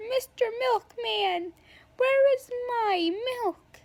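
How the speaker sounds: high, slow, drawn-out silly wail; goofy milk plea. Retold or imitated. Classmate at his locker calling for the milkman, high goofy wail. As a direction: call out slowly in a high put-on voice. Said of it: "he's like".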